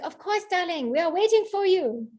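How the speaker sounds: put-on high, welcoming French-accented voice. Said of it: "my best French accent".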